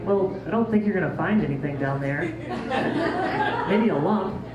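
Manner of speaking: words breaking into laughter; laughing. Real, not acted. Patient laughing as she answers the doctor. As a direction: chuckle through the line. Laughing through retold reply.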